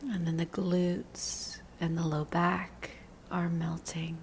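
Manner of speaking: slow, soothing meditation voice with long pauses; unhurried guidance, letting each phrase settle; guided body scan meditation. Real, not acted. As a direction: lead them gently into relaxing, drawing out the phrases.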